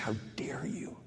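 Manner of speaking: breathless, voiceless hiss of offense; indignant whisper. Retold or imitated. Reading an attacking text, whispering back in outrage. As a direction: whisper it like you've been wronged. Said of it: "immediately I was just like".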